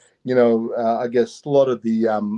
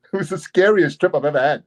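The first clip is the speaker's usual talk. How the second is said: ordinary delivery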